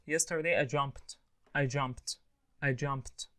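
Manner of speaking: "jumped" as one syllable ending in a crisp T. Shown as a demonstration. Teacher drilling rule number one, jumped ending in T. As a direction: finish "jumped" on "pt", no extra vowel. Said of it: "pronounce it like that".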